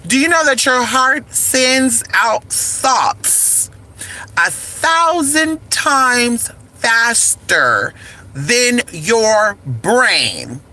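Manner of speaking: very slow, word by word; drawn-out, deliberate question with pauses between phrases; slow emphatic repeat. Real, not acted. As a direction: spell it out for them, stretching each phrase.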